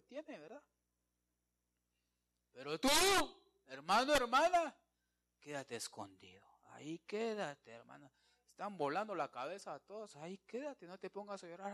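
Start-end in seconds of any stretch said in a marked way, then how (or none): 5.4-8.1 whisper. Acted. Urging listener to stay hidden.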